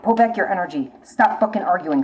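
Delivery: normal talking voice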